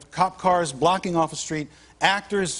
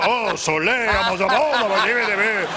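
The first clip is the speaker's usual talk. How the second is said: higher, louder mock French-Spanish babble; excited mock reply; 4 semitones higher, 4 dB louder than usual. Villager thrilled by "Soleil" on a cloudy day, excited babble. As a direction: gush back in delight at nonsense. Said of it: "And they'll go".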